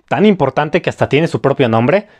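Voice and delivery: ordinary delivery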